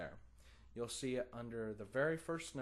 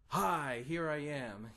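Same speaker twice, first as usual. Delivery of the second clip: loud, higher mock announcement; upfront and forward; 4 semitones higher, 7 dB louder, slower than usual. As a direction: blare it out like a show-off accent. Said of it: "being so upfront and forward about it".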